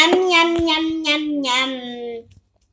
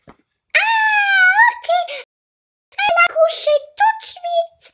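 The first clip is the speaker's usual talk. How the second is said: much higher, louder pony doll voice; Pinkie Pie doll voice; 13 semitones higher, 5 dB louder than usual. Pretend. Pony doll feeding the baby, high pony voice. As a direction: play the pony doll, pitched way up.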